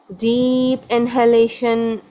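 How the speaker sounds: very slow, drawn-out breathing instructions; guided meditation. Real, not acted. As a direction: stretch each word to guide them into relaxation.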